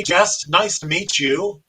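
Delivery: ordinary delivery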